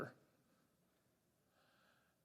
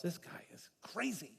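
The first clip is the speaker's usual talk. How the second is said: whispered under the breath; a secret aside only they can hear; whispered thought; 10 dB quieter than usual. Acted. Sailors privately thinking Jonah is crazy, whispered.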